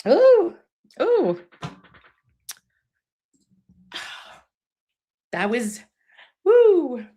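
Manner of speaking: high breathy ooh, catching her breath through tears; happy crying. Real, not acted. Recovering from happy tears in front of her listeners, cracking high. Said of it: "I'm just happy sad".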